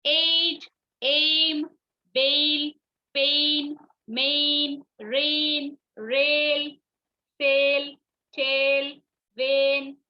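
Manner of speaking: एज, बेल, वेन with the vowel held level; drawn-out A in each word, one by one; long A sound. Shown as a demonstration. Teacher drills A-I spellings, long vowel in एज and पेन. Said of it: "ए आई एक साथ आने से भी long sound".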